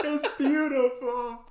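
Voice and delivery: high, slow, loud laughing gush; loud tearful laughing awe. Real, not acted. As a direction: marvel through laughter. Overjoyed at gift.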